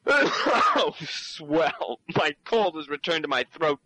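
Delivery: normal talking voice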